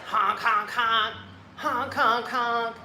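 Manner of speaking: imitated honking. Red car driver flipping out, mock horn blasts. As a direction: keep leaning on the horn. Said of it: "the guy's still like".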